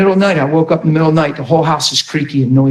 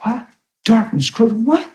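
high incredulous double take; shocked disbelief; 12 semitones higher than usual. Retold or imitated. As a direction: pitch shooting up, incredulous. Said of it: "And I went".

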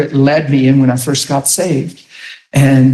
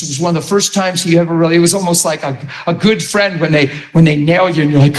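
ordinary talk, nothing marked

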